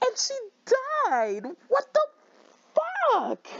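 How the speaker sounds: ordinary delivery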